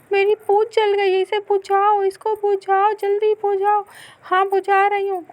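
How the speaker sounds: high, loud panicked begging; panicked plea. Acted. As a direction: beg for help, tail burning. Tail on fire, urgent pleas. (जलती पूँछ पर घबराकर ऊँची गुहार)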